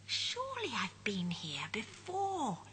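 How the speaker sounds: slow puzzled whisper to himself; muttering whisper. Acted. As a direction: mutter under the breath, bewildered. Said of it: "he mutters".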